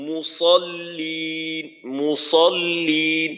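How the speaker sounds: মুসল্লিন said very slowly, with a long final lin; মুসল্লিন with held l and long ee; model pronunciation. Shown as a demonstration. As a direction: draw out মুসল্লিন in measured recitation style. Teacher models the word slowly.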